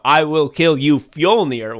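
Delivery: loud, emphatic threat; hammed-up yelled oath; mock yell. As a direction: belt out the vow like an avenging hero. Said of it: "you yelled that ten times".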